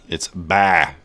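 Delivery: "buy" said plainly as the right word; correction. Shown as a demonstration. Trader fixing his own slip, plain correction. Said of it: "I said bar".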